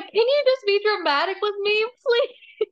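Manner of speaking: high, whiny mock begging; theatrical plea rising to a mock cry; dramatic pleading. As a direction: plead like a hammy actress near tears. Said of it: "I'm an actress in another life".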